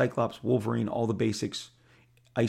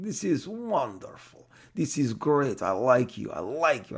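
Avi Arad impression; 3 semitones higher than usual. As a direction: gush it like an eager toy mogul. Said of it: "Avi Arad going".

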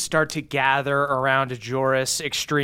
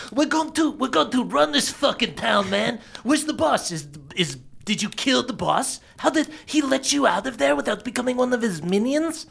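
put-on high, excited kid voices; pumped-up kids; 7 semitones higher than usual. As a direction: kids bouncing with hype, voice up high.

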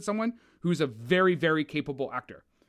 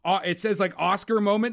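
ordinary talk, nothing marked

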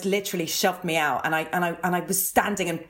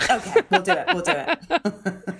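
laughing; 9 semitones higher, 4 dB louder than usual. Real, not acted. Laughing agreement.

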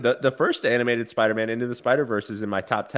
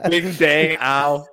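slow, loud cartoon crash sounds; mock crash noise; 4 semitones higher, 7 dB louder, much slower than usual. Joking about a plane crash, slow loud cartoon noises. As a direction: ham up a plane going down, slowly. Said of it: "mock crash noise".

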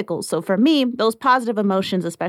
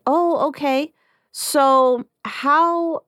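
higher, drawn-out "oh, okay"; acted sales coach, pitched up; role-played sales coach; 7 semitones higher, much slower than usual. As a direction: play a coach smoothly sidestepping an objection. Role-played coach answering client's objection.

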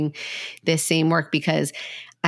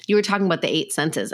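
normal talking voice

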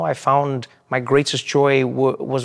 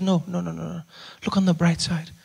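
higher and softer, lightly voiced mom; dismissive mom; 4 semitones higher, 5 dB quieter than usual. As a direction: wave off the fuss gently. Mom brushing off his worry.

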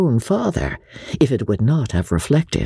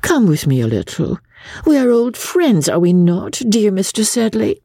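higher-pitched, wheedling lady; foreign lady, coaxing; 10 semitones higher than usual. A narrator's reading. Rebecca invites old friend Joss away, coaxing. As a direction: coax him as a dear old friend.

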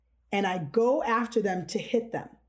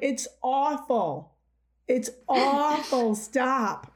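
drawn-out, higher imitation of complaint; mock sibling groan; 4 semitones higher, much slower than usual. Siblings begging the clarinet player to quit, mock exasperation. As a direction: stretch AWFUL in mock agony. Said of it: "They're like".

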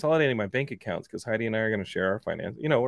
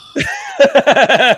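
loud laugh; 13 semitones higher, 11 dB louder than usual. Real, not acted. Laughing at his phone dying.

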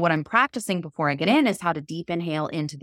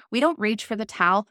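ordinary talk, nothing marked

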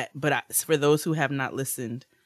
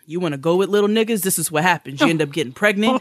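loud stern father voice, fast at the end; lecturing dad; 5 semitones higher, 7 dB louder, faster than usual. Retold or imitated. Father lecturing daughter at two in the morning, loud and stern. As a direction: scold her like a strict dad.

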